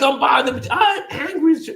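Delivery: worked-up replay of telling someone off; retold anger. Housing worker tells off a pregnant teen, high retold anger.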